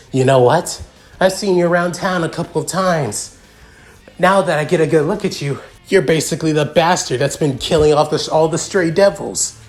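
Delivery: worked-up, cocky villain; ranting villain. Acted. Voices Freed ranting at Noctis.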